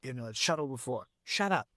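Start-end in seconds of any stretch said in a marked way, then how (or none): 1.2-1.7 higher-pitched mock-irritated cut-off; mock angry. Boss silencing objecting writers, mock angry. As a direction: cut in as a fed-up boss, lightly. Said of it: "you sound very angry there".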